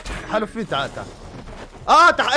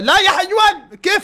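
higher, louder playful insult; mock outrage; 10 semitones higher, 5 dB louder, slower than usual. Friend found his bag empty, playful outraged insult. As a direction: fake anger at his friend.

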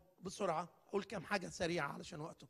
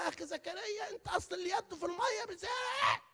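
higher, half-shouted teasing reply; mock retort; 10 semitones higher than usual. Preacher mimicking a sharp comeback, mock shout. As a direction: toss it back with a mocking shout. Preacher answers the complaint mockingly.

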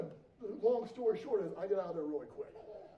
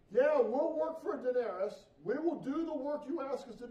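eager workers; 6 semitones higher, 6 dB louder than usual. Acted. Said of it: "They agreed on a price".

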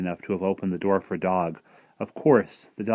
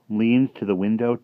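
usual speaking voice

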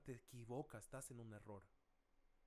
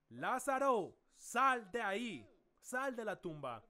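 loud, raised, drawn-out call, easing toward the end; commanding shout; 4 semitones higher, 8 dB louder, slower than usual. Acted. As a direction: shout a slow command into the tomb. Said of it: "Jesús gritó".

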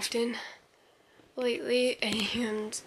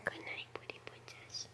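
whispering over a crib; 3 semitones lower, 14 dB quieter than usual. Real, not acted. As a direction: hush the goodnight over the crib.

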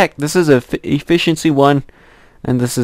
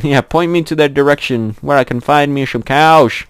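ordinary delivery

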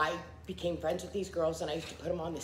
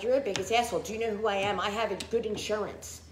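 indignant outburst; 5 semitones higher than usual. Retold or imitated. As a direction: throw it back at the doctor, offended. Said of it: "I was like".

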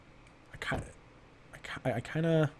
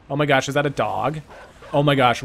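ordinary talk, nothing marked

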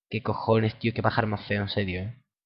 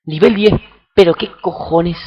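shocked outburst; 5 semitones higher, 9 dB louder than usual. Real, not acted. Sees level ten enemy.

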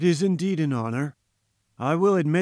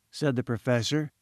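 usual speaking voice

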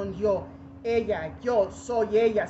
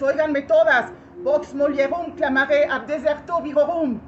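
loud, raised declamation; crying out; 4 semitones higher, 7 dB louder than usual. Acted. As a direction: call to all of them to listen. Cries out to all women.

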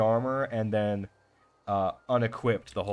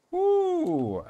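very high "woo" sliding down; excited whoop; 4 dB louder than usual. Real, not acted. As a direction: let out a long, high whoop. Cheers at game loot.

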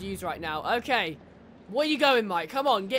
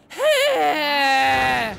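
loud, high sudden cry; startled shout; 4 semitones higher, 8 dB louder than usual. Real, not acted. Gamer startled by the wolf, loud shout. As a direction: jump and yell.

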